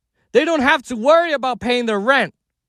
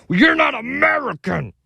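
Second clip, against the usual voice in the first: mocking voice. Mocking pundits' retort.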